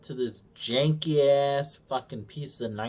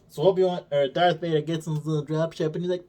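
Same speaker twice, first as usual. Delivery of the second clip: usual speaking voice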